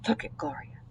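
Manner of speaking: hushed whisper. Acted. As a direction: whisper to a classmate. Children whispering again.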